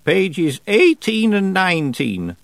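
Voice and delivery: put-on high, slow, deliberate old woman; old witch voice. A narrator's reading. Old witch telling Tiffany which pages, slow and high. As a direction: speak as the stern old witch reading. Narrator voices Miss Treason.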